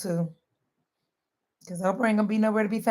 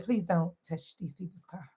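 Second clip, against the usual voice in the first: quiet warning; 3 semitones lower, 11 dB quieter than usual. Retold or imitated. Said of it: "And I said".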